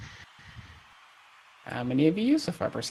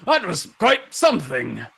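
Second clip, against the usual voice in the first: slow, hearty praise; grand veteran honoring a fighter; admiring old champion; 5 semitones higher, slower than usual. Acted. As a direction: stretch the words with booming approval.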